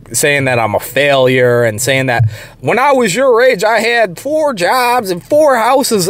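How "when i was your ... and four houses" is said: higher, drawn-out imitation of a ranting coworker; mock yelling. Older coworker berating him about his failure, mock yelling. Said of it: "just starts yelling at me".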